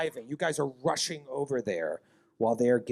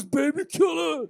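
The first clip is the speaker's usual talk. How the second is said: shout; 14 semitones higher, 5 dB louder than usual. Acted. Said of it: "He calls".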